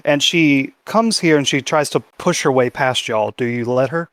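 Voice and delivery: usual speaking voice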